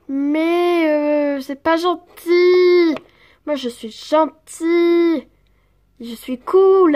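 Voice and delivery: put-on loud, drawn-out childish protest; slow, loud, comic sulk; whining sulker. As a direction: whine it, stretching the last word. Jean-Claude sulks after being insulted.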